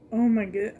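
ordinary talk

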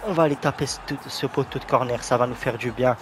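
usual speaking voice

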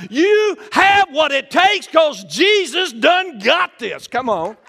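loud, high preaching climax; full-force preaching. Acted. Pastor assuring the congregation they are called, shouting. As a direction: build it up to a shout.

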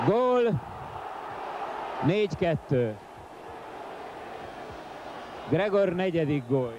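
high excited goal shout; excited hungarian sports commentary. Real, not acted. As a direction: call the goal with a burst of excitement. Fourth goal call.

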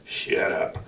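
a whispered, half-joking shut up; whispered mock scold; hushed shut up. Real, not acted. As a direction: hiss it at the chatty crowd like a mock-stern usher. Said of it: "Not while I'm reading Scripture".

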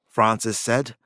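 ordinary delivery, nothing marked

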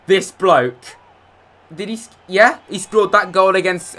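ordinary talk

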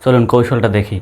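normal talking voice

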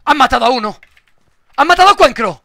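loud, high alarmed call; shocked shout. Real, not acted. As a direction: cry out the bad news. Teammate just got killed.

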